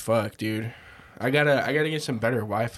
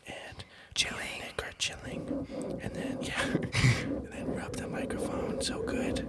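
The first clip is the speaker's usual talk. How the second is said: soft unvoiced ASMR whisper; 8 dB quieter than usual. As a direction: breathe it right into the mic. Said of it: "A S M R".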